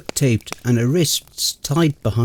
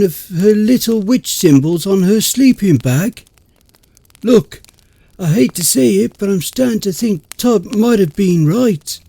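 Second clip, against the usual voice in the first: panicked rant; 7 semitones higher, 4 dB louder than usual. Acted. Heated panicked argument.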